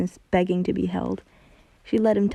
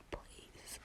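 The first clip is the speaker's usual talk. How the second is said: barely voiced, much softer whimper; a tiny breathless plea; 26 dB quieter than usual. A narrator's reading. Hunter begging Luz, soft whimpered plea. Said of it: "he whimpered".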